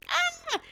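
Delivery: very high imitated yelp of losing balance; mock startled cry. Old lady loses her balance on the steeple, high mock cry. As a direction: squeal it high, like someone toppling. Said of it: "I can see her losing her balance".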